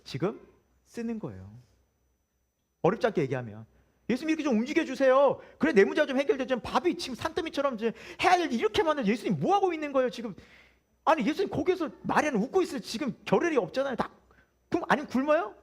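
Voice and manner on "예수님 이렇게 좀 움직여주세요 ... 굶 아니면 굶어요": higher, hurried pleading complaint throughout; frazzled woman nagging Jesus to act; exasperated Martha. Retold or imitated. Martha pressing Jesus while swamped with cooking, higher and exasperated. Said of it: "이런 마음인 거예요".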